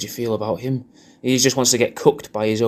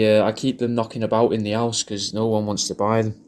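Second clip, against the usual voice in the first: ordinary talk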